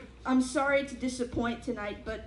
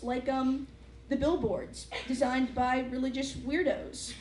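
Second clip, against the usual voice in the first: usual speaking voice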